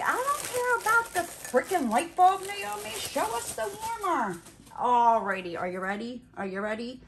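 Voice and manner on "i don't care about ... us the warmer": impatient high-pitched viewer voice; mock the impatient viewer, high and loud. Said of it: "And you're like".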